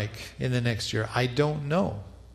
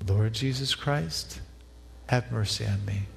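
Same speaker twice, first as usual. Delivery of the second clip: breath prayer. Shown as a demonstration. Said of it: "Why don't you try it with me".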